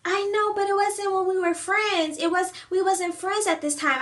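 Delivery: mock the friend acting innocent; playing innocent. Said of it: "she tries to play innocent".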